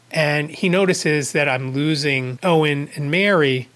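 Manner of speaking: ordinary delivery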